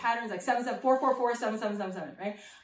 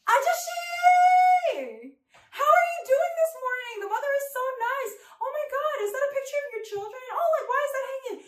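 very high, gushing mock mom voice; chatty mother; 13 semitones higher, 3 dB louder than usual. Mother leaning forward to chat up the taxi driver, high and gushing. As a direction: rattle on in an exaggerated high-pitched mother's voice. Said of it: "but my mother".

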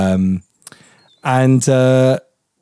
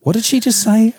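higher, scandalised bystander voice; imitating shocked strangers; 10 semitones higher than usual. Podcaster mimics strangers overhearing her partner. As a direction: play a stunned passerby. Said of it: "people around us were like".

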